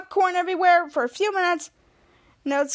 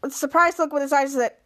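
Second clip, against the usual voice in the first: ordinary delivery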